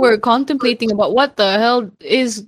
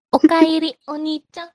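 put-on raised cutesy anime girl voice; sweet little anime greeting, pitched up; 6 semitones higher than usual. Shown as a demonstration. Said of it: "that cute anime girl Oni-chan voice".

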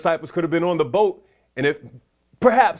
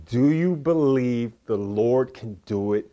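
slow, lowered, drawn-out question; solemn challenge; 4 semitones lower, slower than usual. Real, not acted. Preacher asks congregation about their belief, slow solemn challenge. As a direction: draw each word out, pitched low.

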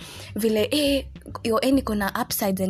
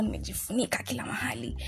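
whispering; 9 dB quieter, faster than usual. Retold or imitated. Hiding herself on campus.

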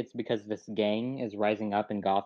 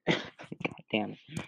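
ordinary delivery

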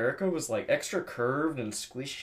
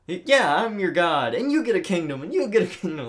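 loud, higher, repetitive mock decree; mock proclamation; 5 semitones higher, 6 dB louder than usual. Parodying the villain handing out kingdoms, loud mock proclamation. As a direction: dole out favors like a self-declared god.

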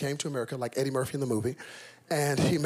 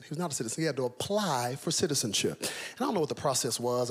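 normal talking voice

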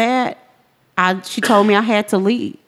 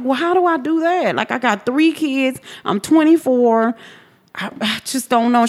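retold helplessness; 7 semitones higher than usual. Retold helpless protest to neighbor.

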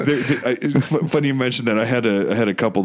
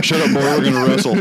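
put-on father, higher and louder, playful threat; hammed-up dad voice; 6 semitones higher, 4 dB louder than usual. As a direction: boom it out like a dad picking a mock fight. Son teases dad, dad answers.

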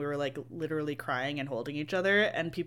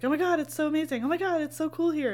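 fast, higher, bubbly gushing; giggly hyper; 10 semitones higher, 4 dB louder, faster than usual. Retold or imitated. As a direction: gush like you can't contain it. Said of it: "hyper and, like, giggly about everything".

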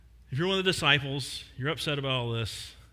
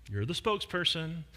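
normal talking voice

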